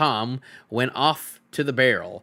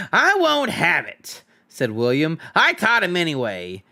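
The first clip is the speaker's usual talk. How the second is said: indignant troll William; 4 semitones higher than usual. Acted. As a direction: object stubbornly like a sulky troll. William objects.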